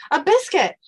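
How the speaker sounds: high, loud comic pleading; begging dog. Acted. Kennel dog begging as Dean passes, pleading.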